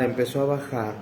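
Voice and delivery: ordinary talk, nothing marked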